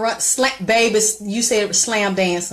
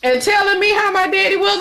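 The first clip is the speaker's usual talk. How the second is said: laughing; 11 semitones higher, 4 dB louder than usual. Real, not acted. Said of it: "I'm laughing".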